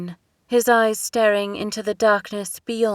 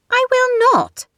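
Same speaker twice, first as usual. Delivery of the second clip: much higher and louder outburst; cried out; 8 dB louder than usual. A narrator's reading. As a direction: cry it out in refusal. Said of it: "Elena cried".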